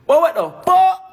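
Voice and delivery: high, loud hammed-up outburst; mock yell. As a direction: shout it in a put-on voice.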